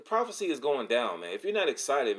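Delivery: excited, pitch jumping up on the good news; real excitement. Real, not acted. Preacher telling listeners prophecy is going down, excited. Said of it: "I'm a little bit excited".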